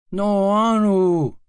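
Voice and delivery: high, loud whiny refusal; whiny child. Acted. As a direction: protest like a scared little boy. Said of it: "say F R E D D I E".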